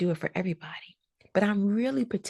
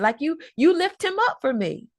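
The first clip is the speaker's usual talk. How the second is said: usual speaking voice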